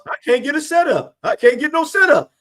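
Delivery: high, loud panicky whine; mock panicked QB wailing about no time; panicked quarterback. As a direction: squeal it like a rattled passer. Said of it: "when Vic did with the Bucs".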